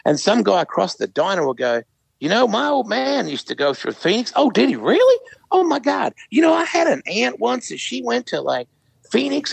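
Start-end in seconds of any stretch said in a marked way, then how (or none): 2.2-4.3 put-on high-pitched Southern American accent; chatty diner guy in a Southern accent. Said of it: "Your Southern accent is spot on". 4.4-9.5 put-on gushing in a Southern American accent. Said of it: "Your Southern accent is spot on".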